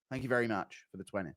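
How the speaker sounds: usual speaking voice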